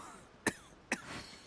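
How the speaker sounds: a drawn-out, strained cough; weak rasping cough. Acted. Sick Teppei greeting friends, weak rasping cough. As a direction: cough like your body is giving out. Weakened soldier after delusion use.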